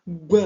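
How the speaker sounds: "Gou" alone, punched loud; pronunciation demo. Shown as a demonstration. Teacher picking the letter out of the word, loud "Gou". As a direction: isolate the "Gou" sound with force. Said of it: "ça prononce Gou".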